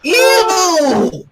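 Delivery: high, loud ew shout; gleeful yelled ew; disgusted cry. Acted. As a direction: shriek it mid-laugh. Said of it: "Rainbow cried".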